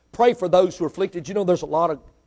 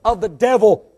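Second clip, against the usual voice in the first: loud, raised, fervent plea to heaven; calling on God; 6 semitones higher, 10 dB louder than usual. Acted. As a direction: preach out a prayer, stretching the key words. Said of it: "Call on God and say".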